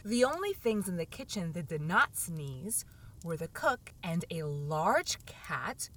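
usual speaking voice

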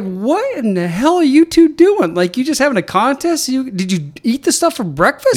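high-pitched, stretched-out incredulous questions; mock disbelief. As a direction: retell it as an exasperated, baffled scolding. Retelling his shocked reaction to smelly teammates.

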